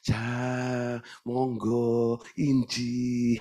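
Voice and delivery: soft, demure Javanese courtesy; hushed, gracious Javanese manners; refined Javanese politeness. Retold or imitated. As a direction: speak like a well-mannered Solo lady. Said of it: "Kalau ngomong juga begitu".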